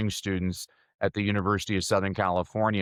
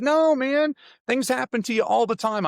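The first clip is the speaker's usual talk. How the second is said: much higher and louder, insisting; emphatic insistence; 13 semitones higher, 4 dB louder than usual. Retold or imitated. Teacher insisting to doubtful six-year-olds, loud and high. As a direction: burst out insisting that things happen all the time. Retold insisting to first graders.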